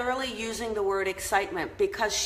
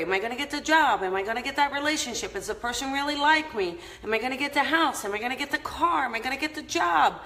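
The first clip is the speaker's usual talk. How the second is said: fast, higher anxious questions; rushed worrying; 4 semitones higher, faster than usual. Retold or imitated. Vlogger voices a viewer's anxious thoughts, fast and higher. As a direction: rattle off the worries quickly.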